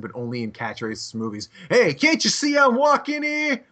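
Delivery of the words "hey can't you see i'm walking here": loud, high, slow New York catchphrase; hammed-up New York movie accent. Teasing friend with movie catchphrases, New York accent. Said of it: "you speak with, like, a New York accent".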